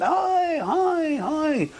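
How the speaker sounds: high calls that fade over three hi's; retold shouting. Survivors shouting up at a ship, calls fading. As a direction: hail a distant ship from a lifeboat. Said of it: "So we started shouting".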